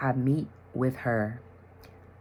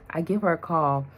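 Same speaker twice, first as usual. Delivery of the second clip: usual speaking voice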